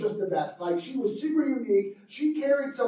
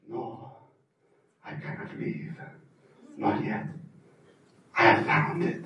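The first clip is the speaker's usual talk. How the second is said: obsessed hushed voice; 11 semitones lower, 5 dB quieter than usual. Acted. Fernando obsessed in cave.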